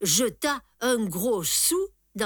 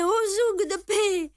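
much higher, louder repeated call; old cobbler crying out; 11 semitones higher, 3 dB louder than usual. A narrator's reading. Old cobbler calling again for the police, high loud cry. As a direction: keep shrieking for the police. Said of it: "Un vieux cordonnier juif criait".

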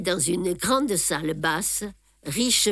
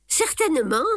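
a touch of laughter, higher and brighter; laughing; 7 semitones higher, 3 dB louder than usual. A narrator's reading. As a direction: say it with a light laugh. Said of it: "dis-je en riant".

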